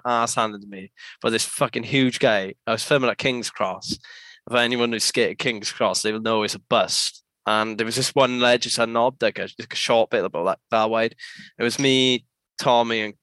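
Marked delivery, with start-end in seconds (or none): none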